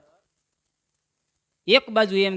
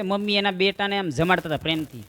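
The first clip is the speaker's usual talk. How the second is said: ordinary delivery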